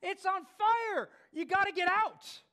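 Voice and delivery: high, loud, insistent warning; pleading clown. Retold or imitated. Clown insists to a laughing audience he is serious, high urgent shout. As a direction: plead at the top of your voice to be believed. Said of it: "clown's like".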